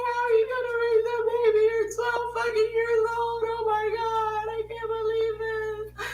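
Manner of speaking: very high, loud imitation of mother; mock wailing mom. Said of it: "she was like".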